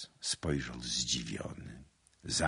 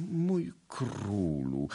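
slow, drawn-out pleading, raised pitch; plaintive; 4 semitones higher, much slower than usual. A narrator's reading. Guilty suitor begging his lady's pardon, plaintive. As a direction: stretch the endearment pitifully. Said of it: "zawołał wreszcie żalosnym głosem".

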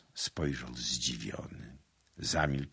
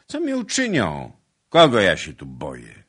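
defiant boast; 11 dB louder than usual. A narrator's reading. Suitor boasting to his lady of fearing nobody, defiant. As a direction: fling both questions out boldly.

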